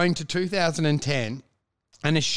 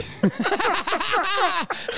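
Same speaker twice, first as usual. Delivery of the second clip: high laugh; 12 semitones higher than usual. Real, not acted. Laughing at the joke.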